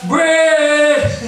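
high, loud, stretched-out shout; loud calling out. Retold or imitated. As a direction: holler his name to get through. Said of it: "a little later".

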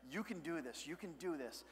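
put-on hushed encouragement to a toddler; soft coaxing. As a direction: coax her gently, almost under your breath.

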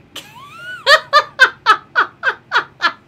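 long, high cackling laugh; hysterical laughter. Real, not acted. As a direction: burst into loud, rhythmic high laughter. Cracking up at the game.

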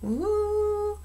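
high siren wail. A narrator's reading. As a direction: imitate a fire siren sliding up. Siren imitated after clangs.

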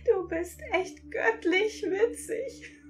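high, slow words through laughter; laughing while talking. A narrator's reading. Sprite teasing the narrator, laughing high. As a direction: speak through tears of laughter. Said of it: "mit Lachtränen in den Augen".

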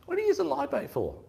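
can't believe anyone uses live bait; incredulous question. Retold or imitated. Mate mocks live bait.

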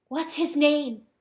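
demanding. A narrator's reading. Enraged hen questioning the rooster, demanding. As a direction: press hard for an answer. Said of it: "she demanded".

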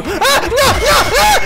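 very high drawn-out no; panicked scream. Real, not acted. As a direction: scream as you're caught. Spotted, screams.

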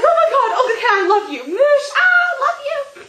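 high, loud squealing adoration; acted-out fan shriek; gushing fangirl. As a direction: squeal and smother her with love. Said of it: "And I'm like".

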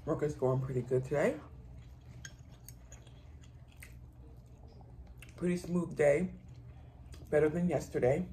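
talking with mouth full; eating between words; chewing food. Real, not acted. Seen 0: Takes forkful at 0.8, closed-mouth chewing through the words.

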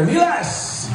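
name called out high; announcer shout. Acted. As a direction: call the name out to the crowd.

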